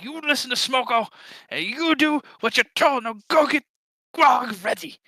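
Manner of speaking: high-pitched goblin scolding; bossy goblin. Acted. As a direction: lay down the law in a high goblin voice.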